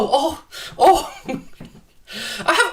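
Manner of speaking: amused laugh. Real, not acted. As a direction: giggle through the words. Laughing at a chat comment.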